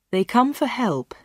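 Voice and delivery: ordinary delivery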